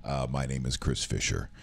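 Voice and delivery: low, rough morning-after voice; croaky hungover talk. Real, not acted. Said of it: "this is my hungover voice".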